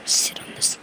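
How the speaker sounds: sneaky whisper. Pretend. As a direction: whisper it under your breath. Whispered aside.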